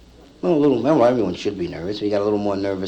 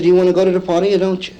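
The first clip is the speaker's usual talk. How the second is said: impatient push; 7 semitones higher, 4 dB louder, faster than usual. Retold or imitated. Impatient friend pushing the party.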